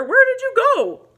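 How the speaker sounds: high, loud puzzled hey; loud call. Acted. Bear calling after Mouse flew off, high and loud. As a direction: call out looking around.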